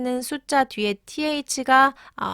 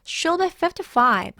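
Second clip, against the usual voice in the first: higher, slow, with weight on "fifty-five"; stress on fifty-five; 6 semitones higher, much slower than usual. Shown as a demonstration. Teacher repeating the example for learners, "fifty-five" stressed. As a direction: punch "fifty-five" as the key information. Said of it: "fifty-five is stressed here".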